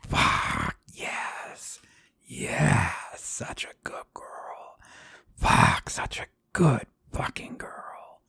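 intense, mostly voiceless praise; breathy whisper. Acted. Lover praising adult partner at the peak, breathy whisper. Climax of acted intimate scene.

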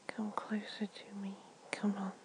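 usual speaking voice

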